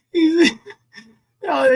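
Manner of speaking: normal talking voice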